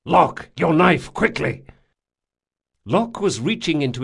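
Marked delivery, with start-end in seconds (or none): 0.0-1.6 loud, pressing shout to a partner; urgent call. A narrator's reading. Jean urging Locke mid-fight, urgent shout. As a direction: hurry him, voice raised.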